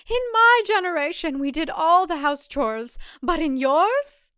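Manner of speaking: put-on older woman near tears; voice cracking. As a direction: let the voice crack on the last words. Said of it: "Her voice cracks".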